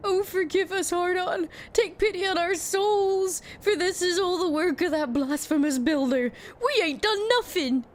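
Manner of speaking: high, slow, fearful prayer; pleading villager. Acted. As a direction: beg for mercy, trembling. Voices frightened pleading villagers.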